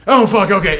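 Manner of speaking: paranoid. Retold or imitated. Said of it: "this movie had me that paranoid".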